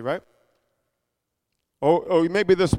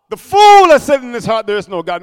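'The fool has said in his heart' belted out much louder, then 'There is no God' dropping back; loud proclamation; 2 semitones higher, 10 dB louder than usual. Acted. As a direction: hammer 'fool' hard and loud. Proclaims the verse loudly.

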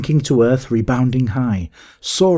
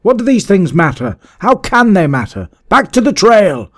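high, loud, exasperated outburst; impatient shouting; 10 semitones higher, 7 dB louder than usual. A narrator's reading. Master ordering men back to the trail, impatient shouting. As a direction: shout your riders back into line. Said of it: "seized with a sudden unreasonable impatience".

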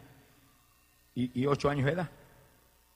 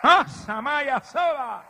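loud, high, drawn-out cry; ecstatic tongues outburst; 10 semitones higher, 6 dB louder, much slower than usual. Real, not acted. Preacher breaking into tongues mid-testimony, ecstatic shout. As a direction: burst into slow, high shouted syllables.

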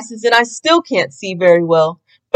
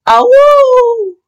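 long, loud, high howl; a drawn-out monster howl, loud and high; 6 dB louder than usual. Acted. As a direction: howl it out like a beast in the hall. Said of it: "Howling filled the hall".

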